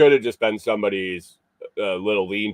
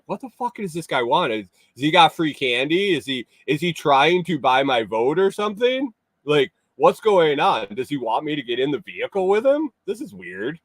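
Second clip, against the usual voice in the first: quoting his own thoughts; 6 semitones higher than usual. Retold or imitated. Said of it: "I'm like".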